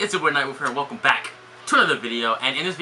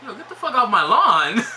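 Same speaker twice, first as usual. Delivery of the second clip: high, loud mock yell; shouting; 9 semitones higher, 4 dB louder than usual. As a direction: yell someone off your lawn. Yelling at the doorbell woman.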